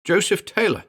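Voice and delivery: Joseph Taylor cried out high and loud, choked; choked grief. Acted. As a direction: force Joseph Taylor out through a tight throat. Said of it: "Elliot choked".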